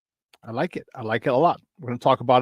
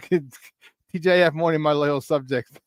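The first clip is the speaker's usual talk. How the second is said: usual speaking voice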